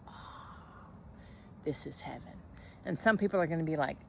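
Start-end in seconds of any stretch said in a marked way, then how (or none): 0.1-2.4 much softer, breathy bliss in the sun; sun-lover sighing, softer; blissful sigh. Retold or imitated. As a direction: melt into the warmth, soft. Person loving the sun.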